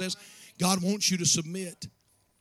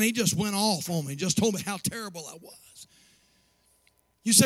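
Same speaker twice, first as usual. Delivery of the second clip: ordinary delivery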